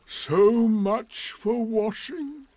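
slow, high, drawn-out grumble; grumbling donkey. Acted. Gloomy donkey grumbling about washing, slow and sour. Eeyore voice.

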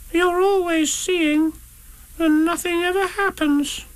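very high, slow, plaintive Roo; sad. A narrator's reading. Roo laments to Kanga that outings never happen, sad squeak. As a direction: complain sadly in a little squeaky voice. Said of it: "said Roo sadly".

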